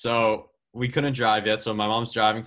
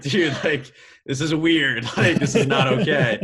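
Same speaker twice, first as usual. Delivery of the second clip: laughing disbelief; 3 semitones higher, 6 dB louder than usual. Retold or imitated. Disbelief walking up to green.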